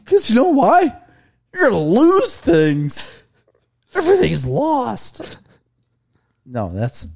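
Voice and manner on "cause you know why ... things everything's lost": mock crying dad. Mock dad sobbing to kids.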